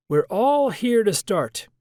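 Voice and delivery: Washington voice. Acted. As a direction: address the room from the podium. Washington addresses the debate hall.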